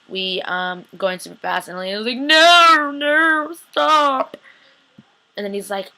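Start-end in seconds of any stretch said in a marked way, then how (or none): 2.2-4.4 high, loud drawn-out mock wail; acted panicked scream. Recapper as Elena begging the speeding driver, high panicked yell. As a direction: cry out in hammed-up terror.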